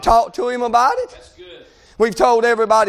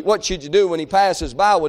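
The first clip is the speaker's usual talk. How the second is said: ordinary talk, nothing marked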